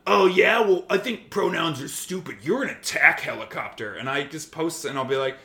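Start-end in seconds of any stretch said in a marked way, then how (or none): 0.1-3.9 louder smug sneer, lightly voiced; a hint of a smug bigot; mock troll voice. Imitating a transphobic commenter with a mild smug sneer. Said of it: "someone's gonna come in and be like".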